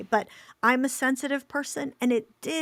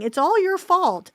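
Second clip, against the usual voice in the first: a sudden loud, high burst of blame; imagined accusation; 9 semitones higher, 8 dB louder than usual. Retold or imitated. Friend voicing the blame she fears parents hear, loud mock accusing. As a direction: fling the accusation out.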